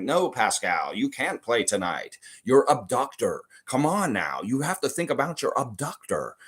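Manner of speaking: lightly voiced hard-ass doctor; mock hard-ass doctor. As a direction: play a mildly stern doctor refusing a player. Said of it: "could have been like a hard ass".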